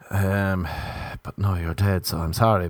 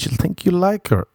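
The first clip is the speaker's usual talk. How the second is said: ordinary talk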